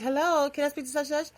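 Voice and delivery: mock sweet caller asking for someone; prank caller voice. [name] mimics a caller asking for someone, put-on phone voice.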